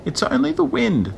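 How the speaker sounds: very high, squeaky little creature; high, reassuring squeak; tiny voice. A narrator's reading. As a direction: explain it in a teeny shell voice. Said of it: "the tiny voice explained".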